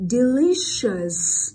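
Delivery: Delicious loud and drawn out, long sh. Shown as a demonstration. Teacher models cy spelling rule, Delicious slow with long sh. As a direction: stretch "Delicious" slowly through the SH. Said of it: "sh dài luôn nha".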